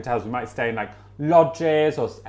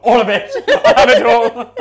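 louder, higher, giving up; exasperated guest; 9 semitones higher, 11 dB louder than usual. Retold or imitated. Guest fed up at the egg hatch, louder and higher. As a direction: blurt it out in defeat. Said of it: "the woman just went".

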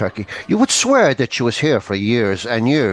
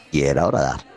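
normal talking voice

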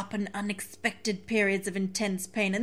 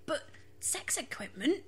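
high, light mocking aside; 13 semitones higher than usual. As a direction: lift it into a silly voice. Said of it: "and I was like".

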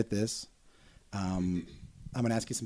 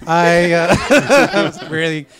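ordinary delivery

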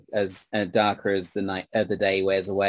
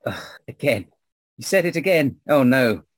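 put-on exasperated old woman; 5 semitones higher, 4 dB louder than usual.

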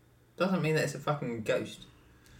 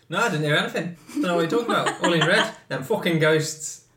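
defensive denial; 5 semitones higher, 6 dB louder than usual. Retold or imitated. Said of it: "someone would be like".

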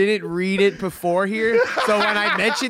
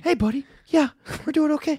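Mark Wahlberg impression; 6 semitones higher than usual. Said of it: "Mark Wahlberg".

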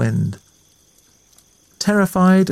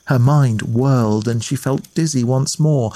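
usual speaking voice

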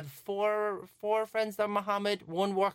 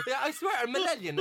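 laughing; 7 semitones higher than usual. Real, not acted. Laughing at his own mistake.

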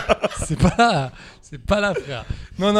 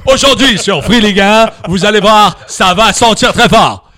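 Alexandre Ruiz; 11 dB louder than usual. Retold or imitated. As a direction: announce the match at full volume, stretching every phrase. (présentateur sportif survolté, caricaturé)

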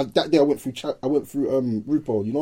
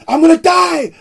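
high, loud panicked yell; retold yell; 10 semitones higher, 6 dB louder than usual. Friend charging at the youths, high loud yell. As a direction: scream it out in a frenzy. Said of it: "I'm like".

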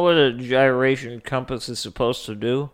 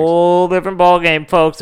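louder, higher, booming to the folks; announcer voice; 5 semitones higher, 4 dB louder than usual. Pretend. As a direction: announce it like a host to the crowd. Repeats line as announcement.

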